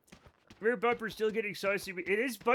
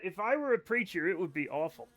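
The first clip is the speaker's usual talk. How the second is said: ordinary talk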